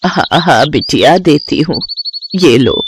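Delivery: put-on gentle elderly seller handing over fruit; old woman. Old seller serving a young girl, lightly aged voice.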